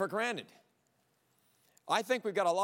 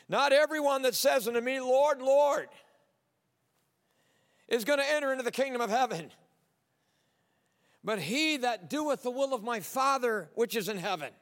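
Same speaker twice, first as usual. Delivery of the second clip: raised and louder, pressing the words; preached quote of Jesus; 5 semitones higher, 4 dB louder than usual. Acted. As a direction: proclaim it from the pulpit. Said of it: "look what Jesus says".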